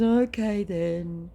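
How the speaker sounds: slow put-on deflated okay; deflated scammer. Scammer giving up at last, slow deflated mock voice. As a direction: let it sag like a beaten scammer.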